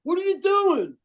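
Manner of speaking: high-pitched yell, slow and stretched out; hammed-up indignant holler; mock outraged shout. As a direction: shout in fake protest at the woman. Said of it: "we're like".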